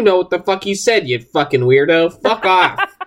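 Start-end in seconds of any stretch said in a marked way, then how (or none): none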